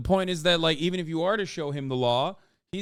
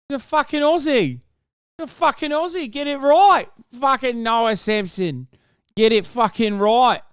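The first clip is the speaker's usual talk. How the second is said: bad Australian accent, much higher and louder; terrible Aussie accent; 7 semitones higher, 6 dB louder than usual. Correcting the co-host about the woman's nationality, bad loud Aussie accent. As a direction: yell it like an angry Aussie, badly done. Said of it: "That's a terrible Aussie accent".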